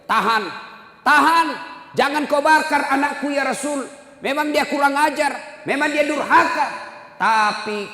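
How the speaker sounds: high, loud pleading cry; mother's shout. Acted. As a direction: plead at full voice to stop them. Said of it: "ibunya berteriak". (teriakan ibu yang memohon)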